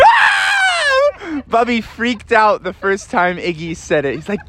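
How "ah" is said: high terrified scream. Acted. Bubby screams in terror.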